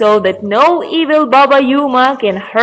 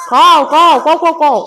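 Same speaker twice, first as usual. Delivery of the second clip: louder, higher insistent caws; croaking raven; 5 semitones higher, 4 dB louder than usual. Acted. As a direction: caw insistently, quickening at the end. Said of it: "starts croaking".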